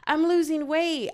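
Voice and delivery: high, loud, proud announcement; excited friend. Retold or imitated. Mimics friends announcing weight loss.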